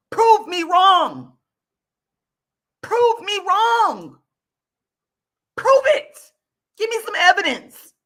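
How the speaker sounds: building from firm to high, loud demand; rising defiant challenge. Real, not acted. Ranting at viewers, escalating to a loud demand.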